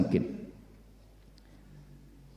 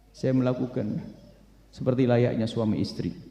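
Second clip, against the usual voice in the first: subdued confession; 2 semitones lower, 5 dB quieter than usual. Acted. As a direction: admit it quietly, voice lowered. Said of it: "Mulai ceritalah dia sambil menangis".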